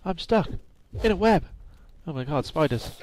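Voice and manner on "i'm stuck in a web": higher, louder alarm at being stuck; alarmed cry. Real, not acted. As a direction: yelp it out in sudden panic. Caught in a spider web.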